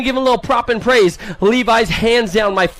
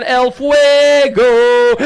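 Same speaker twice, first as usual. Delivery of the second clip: El Fuego held long and louder for the crowd; drawn-out hype call; 3 semitones higher, 4 dB louder, much slower than usual. Acted. Announcer stretching El Fuego over the PA, slow and loud. Announcer calling rider nickname.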